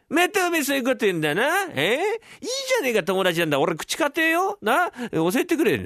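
high, pressing wheedle; insistent friend. Acted. Friend pestering the dreamer to share his secret, insistent.

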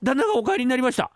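high, stammering alarm; a servant who just spotted the boss; panicked servant. Acted. Servant warns everyone the master is back, high and panicked.

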